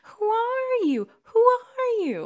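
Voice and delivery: very high, drawn-out squeal of disbelief; mock incredulous outrage. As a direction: stretch it out high, as if appalled. Mock outrage at character's callous gift.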